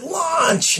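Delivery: high-pitched yell, held back in volume; mock power-word yell, not full force; retold yell. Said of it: "And I yelled it".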